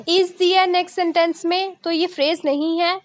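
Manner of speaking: ordinary talk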